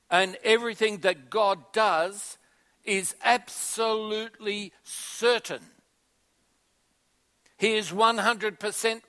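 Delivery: preaching at full force. Acted. Pastor rallying congregation on God's reliability, forceful. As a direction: drive every phrase home to the pews.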